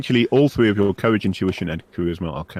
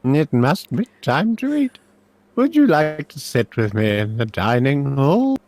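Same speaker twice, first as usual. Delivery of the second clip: put-on high, slow, loud old man voice; elderly magister, hopeful and high-pitched; old professor, inviting; 8 semitones higher, 6 dB louder, slower than usual.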